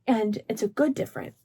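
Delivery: normal talking voice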